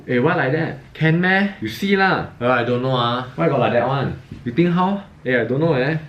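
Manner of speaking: lively high Manglish catchphrases; Manglish slang. Shown as a demonstration. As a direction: toss out Malaysian English particles with lilting tune. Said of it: "some common slangs you'll hear from Manglish".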